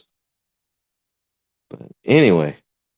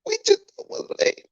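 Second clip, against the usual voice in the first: squeaky falsetto crybaby imitation; hammed-up sobbing protest, sky-high; mock crying; 3 dB louder than usual. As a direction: whine it very high, making fun of the complainers. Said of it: "cry in that goddamn corner".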